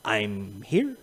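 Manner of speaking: low, hushed, spent voice; barely voiced martyr's declaration; weary resolve. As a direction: say it quietly, as if worn down. Voicing worn-out loyal mindset.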